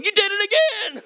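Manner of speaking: high, cracking voice with a laugh. Retold or imitated. Husband retelling his wife's eye roll, voice cracking high. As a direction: let the voice break up high, laughing. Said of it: "I don't know why my voice is cracking".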